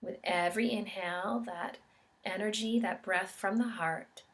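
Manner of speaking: calm, measured meditation guide with long pauses; guided meditation. Real, not acted. Yoga instructor guiding listeners into an expansiveness meditation, calm and unhurried. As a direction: guide them gently through each breath, leaving space between phrases.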